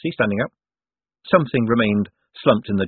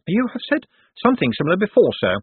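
higher, quick secretary voice; a deferential aide, pitched up and brisk; 11 semitones higher, 4 dB louder, faster than usual. A narrator's reading. Secretary answers his master at the coach, higher and quick.

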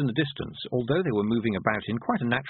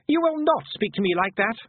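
high-pitched haughty rebuke; indignant boy; 11 semitones higher, 4 dB louder than usual. A narrator's reading. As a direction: scold like an offended little lord.

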